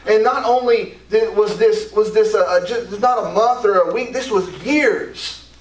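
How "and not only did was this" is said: ordinary talk, nothing marked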